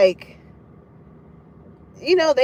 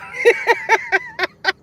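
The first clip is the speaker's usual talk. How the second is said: high, bursting cackle of laughter; real high-pitched laugh; amused laugh. Real, not acted. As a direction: crack up at your own joke. Laughs at own Doctor Sebi comparison.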